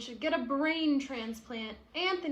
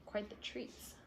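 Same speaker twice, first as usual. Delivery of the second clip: whispered aside; 2 semitones lower, 13 dB quieter than usual. Real, not acted. Aside remark.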